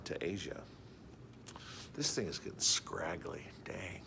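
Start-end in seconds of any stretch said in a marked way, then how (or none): 1.9-4.0 whispered grumble to himself; hushed muttering about the beard. Real, not acted.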